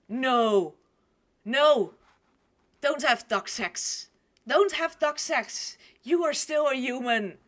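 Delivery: loud, emphatic scolding; protesting outcry. Real, not acted. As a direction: plead loudly with a story character. Scolding the story character.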